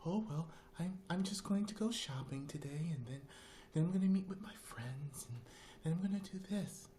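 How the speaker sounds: high-pitched, prim and proper neighbor; dainty, high lady voice; Darlene. Retold or imitated. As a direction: imitate a very proper homemaker, high and soft. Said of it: "That's how she talked".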